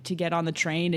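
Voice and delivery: ordinary talk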